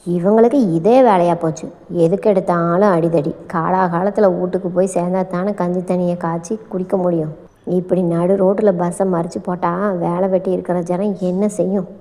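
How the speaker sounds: mildly fed-up, low-pitched complaint; a touch of tired exasperation; weary grumbling. Acted. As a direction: grumble it wearily, low and softened. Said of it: "சலித்து கொண்டாள்".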